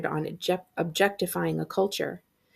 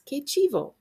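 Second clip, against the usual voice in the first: high, bright burst of delight; delighted exclamation; 8 semitones higher than usual. Acted. Luis thrilled by the pep rally. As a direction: toss it off thrilled.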